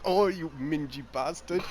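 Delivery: badly faked British accent; Gavin Free. Imitating Gavin hacking a computer, badly faked British accent. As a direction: put on a poor English accent. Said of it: "worst accent I've ever heard".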